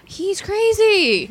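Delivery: "he's crazy" dragged out high and loud; slow emphatic punchline. Real, not acted. Telling friends her date turned out crazy, drawn-out "he's crazy".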